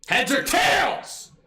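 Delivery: high, slow announcer-like call; ringing coin-toss challenge; emphatic call-out. Pretend. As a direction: call it out, drawing out the last word. Coin-flip call after explaining rules.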